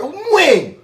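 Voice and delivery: loud, high-pitched disbelief; incredulous. Real, not acted. Host reacting to her claim against him, incredulous. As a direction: cry it out, utterly baffled. Disbelief, it blew the host's mind.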